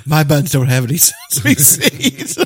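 laughing quote. Retold or imitated. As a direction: giggle through the line. Quoting movie line, laughing.

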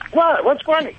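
high, puzzled "What? What's funny?"; surprised. Real, not acted. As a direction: jump up in pitch, caught off guard. Guest reacts to laughter.

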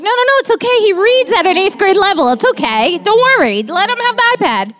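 high, quick defensive reassurance; defensive reassuring. Retold or imitated. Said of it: "I'm like".

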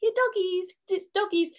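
put-on high sing-song baby talk. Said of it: "because he's a baby, I'll go".

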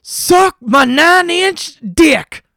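loud, high, drawn-out yell; screaming at the top of the lungs; imitated full-lung scream. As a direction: bellow it slowly like a ranting stranger. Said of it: "he screams at the top of his lungs".

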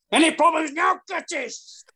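high, strained taunt, dropping away on the name; retold confrontation, pitched right up; in-your-face challenge. Caddie acting Sevi confronting Curtis Strange, high mock challenge. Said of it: "straight in his face".

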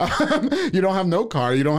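high, laughing mockery. Real, not acted. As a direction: laugh as you tease. Laugh heard, high pitch.

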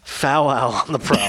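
slow, savoured delivery of the catchphrase; Greg Cody catchphrase. Retold or imitated. Host quoting the co-host's famous catchphrase, slow and savoured. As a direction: drawl it like a columnist's signature line. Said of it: "That's a Greg Cody line".

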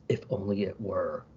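low, hushed cold voice; cold hiss. A narrator's reading. Reading Batman's sinister second thought aloud, cold and hushed. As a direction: read it low and softly, coldly. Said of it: "in a cold voice not his own hisses".

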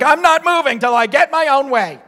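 high, loud whiny tantrum voice; kid throwing a fit. Retold or imitated. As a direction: play a stubborn child stamping his feet. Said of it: "like when a kid throws a fit on the floor".

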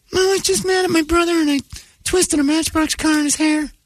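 put-on very high, pleading kid voice; confessing child. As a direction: confess in a squeaky little-kid voice.

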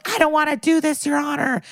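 high-pitched, put-on reluctant plea; mock pleading. Reluctant witness pleading on stand.

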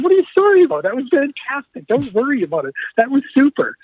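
high, enthusiastic imitation; Ray Ratto. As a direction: brush off an apology in a high, excited voice. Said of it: "this is typical Ray Ratto".

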